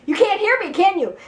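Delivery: high, sing-song brotherly teasing; taunting. Retold or imitated. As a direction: needle them like a cocky little brother. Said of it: "he kept taunting".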